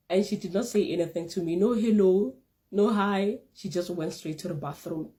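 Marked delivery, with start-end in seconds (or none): none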